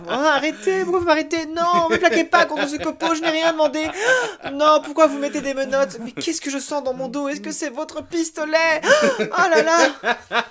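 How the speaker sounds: high loud camp protest; arrested suspect. Acted.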